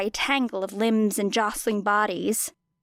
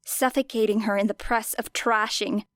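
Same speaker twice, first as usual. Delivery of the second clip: usual speaking voice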